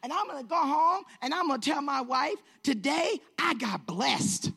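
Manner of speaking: loud, high and triumphant; imitating a man thrilled to be blessed; exultant declaring. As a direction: proclaim it like good news for everyone. Stranger exults over his good deed.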